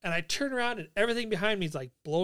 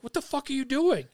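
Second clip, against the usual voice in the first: higher-pitched indignant demand; imitating a superior losing it, only mildly raised; retold outrage; 7 semitones higher than usual. Platoon superior confronting the soldiers, outraged. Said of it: "he's losing his mind".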